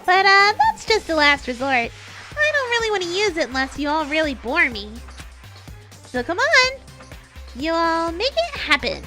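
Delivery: high, loud, hyped announcer voice; fighting game announcer. Acted. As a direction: boom it out like a show host. Announcer voice in story.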